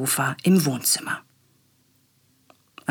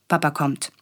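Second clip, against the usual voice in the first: ordinary delivery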